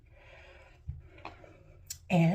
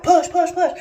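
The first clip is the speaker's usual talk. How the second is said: loud, higher push commands; urging push; 9 semitones higher, 12 dB louder than usual. Retold or imitated. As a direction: urge her on loudly. Nurses urging her to push.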